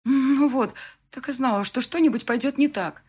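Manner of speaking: high, upset self-reproach; nervous fretting. A narrator's reading. Bride sure the wedding is going wrong, nervous. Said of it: "начала нервничать я".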